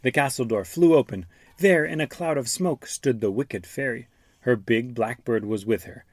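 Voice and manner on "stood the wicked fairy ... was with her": usual speaking voice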